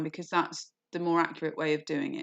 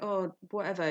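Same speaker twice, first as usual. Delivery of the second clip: bored dismissal. Retold or imitated. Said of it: "are not interested anymore".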